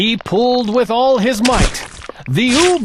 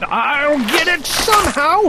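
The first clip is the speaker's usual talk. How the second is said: yelling; 6 semitones higher than usual. A narrator's reading. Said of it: "he yelled".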